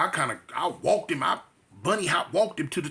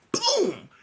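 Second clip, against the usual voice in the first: punch boom. Retold or imitated. Punch sound in a fight story.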